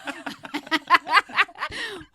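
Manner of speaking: loud burst of real laughter; big laugh. Real, not acted. Guest laughing out loud about her husband's bellies. Hearty laugh at own joke.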